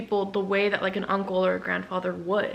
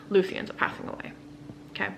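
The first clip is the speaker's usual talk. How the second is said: ordinary talk, nothing marked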